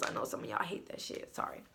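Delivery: half-whispered, dropped right down; whispered aside. Real, not acted. To viewers, a whispered aside about knuckle cracking. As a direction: toss it off under the breath.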